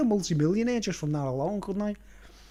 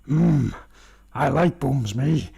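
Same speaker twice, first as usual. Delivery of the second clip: leering Mango; 4 semitones lower, slower than usual. Retold or imitated. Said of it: "the Mango's going".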